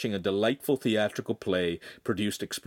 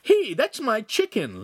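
high, slow whiny protest; complaining; 13 semitones higher, 5 dB louder, slower than usual. Acted. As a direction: whine like a hungry kid. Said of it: "Lincoln complained".

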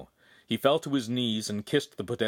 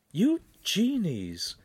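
high, slow chiding woman's voice; Charlene's voice; 10 semitones higher, much slower than usual. A narrator's reading. Charlene chiding Pan for meddling, higher and slow. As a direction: draw it out in higher reproach.

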